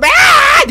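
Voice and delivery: sudden high mock scream; hammed-up scream of a game character; imitated scream. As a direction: copy the game man's shriek. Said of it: "diye bağırıyor".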